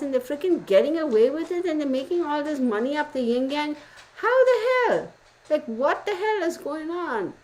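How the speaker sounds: high, worked-up disbelief, peaking at how the hell; incredulous rant. Retold or imitated. Retelling her reaction to the tea-spilling girl, high incredulous rant. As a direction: rant like you can't believe your ears. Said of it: "I was like".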